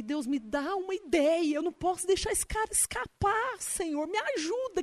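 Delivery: high, hushed plea to God; urgent prayer. Retold or imitated. Praying silently to God for a way in, pleading. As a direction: beg God for an idea under your breath.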